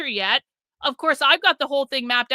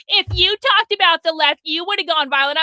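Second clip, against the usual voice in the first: louder, higher imitation of hysterical commenters; mock screaming; 4 semitones higher, 5 dB louder than usual. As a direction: mimic outraged commenters yelling. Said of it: "screaming and crying and going".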